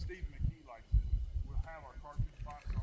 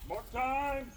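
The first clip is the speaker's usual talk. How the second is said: drill commands; 9 semitones higher, much slower than usual. Real, not acted. Instructor leading the squad's march drill, called commands. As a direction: call the march orders to the squad. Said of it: "drilling the group".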